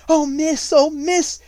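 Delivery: put-on high, loud, panicked servant girl; frightened stutter. A narrator's reading. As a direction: blurt it out in terror, near a sob. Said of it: "she stuttered".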